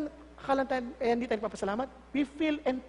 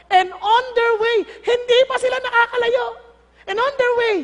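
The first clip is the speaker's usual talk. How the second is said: excited; 9 semitones higher, 8 dB louder than usual. A narrator's reading. Climactic retelling, rising excitement.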